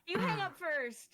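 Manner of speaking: giggly late night call. Pretend. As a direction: drag it out like a giddy couple on the phone. Playful "no, you hang up" goodbye bit.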